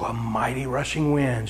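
low hushed whisper of a mighty wind; dramatic whisper. Acted. Preacher telling a miracle, awed whisper. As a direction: breathe it out like awe.

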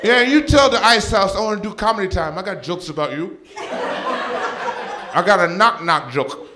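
high, loud imitation of a boastful mom; talking smack. Mom belittles her son's comedy career, high-pitched trash talk. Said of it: "she talks smack to me".

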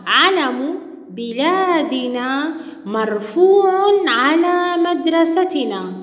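teacher modeling vowelled reading; slow model reading. Shown as a demonstration. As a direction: read each word slowly for children. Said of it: "الجملة الأخرى".

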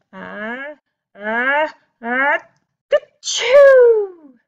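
building ah-ah-ah into a loud ka-choo; big sneeze. A narrator's reading. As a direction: wind up slowly, then explode. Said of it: "gave an enormous sneeze".

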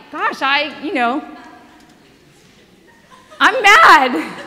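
mock mad. Friend's frustration voiced laughing.

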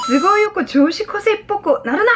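high-pitched exasperated protest; acted indignant telling-off; scolding daughter. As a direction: snap at dad in a high girlish voice. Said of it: "딸이 뭐라고 하냐면".

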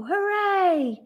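high, loud "hooray" cheer. A narrator's reading. Team celebrates Wilson's goal, cheering. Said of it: "His team cheered".

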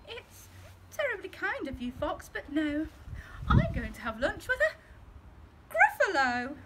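high, bright little mouse voice. Acted. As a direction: answer politely like a clever small mouse. Mouse voiced in read story.

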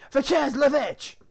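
very high, very loud, drawn-out mock French command; insistent French shout. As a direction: shout the French order at slow men. Guard repeats the order, insistent.

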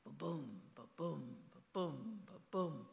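ba-boom, ba-boom, weight on boom; heartbeat rhythm. Shown as a demonstration. Author comparing heart sounds, stressing each boom. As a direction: beat it out like a heart. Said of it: "um, iambic".

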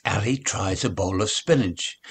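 ordinary delivery, nothing marked

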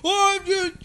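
high-pitched mock rant; cop going off. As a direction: rattle on in a high, whiny-angry cop caricature. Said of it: "He keeps going off".

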